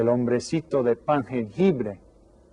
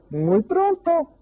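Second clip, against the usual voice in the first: put-on old woman's reply; slower than usual. A narrator's reading. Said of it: "dijo la viejita".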